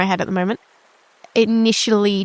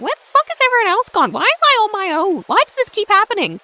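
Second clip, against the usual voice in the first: high, fast, hammed-up dismay; mock panic; 11 semitones higher, faster than usual. Player finding everyone else gone, high mock-panicked complaint. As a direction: wail it out fast and much higher. Acts out being left alone.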